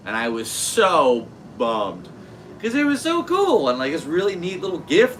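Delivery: lively mock dismay, high and loud; excited. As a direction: build up into a high, loud wail about the gift. Retells losing the gift.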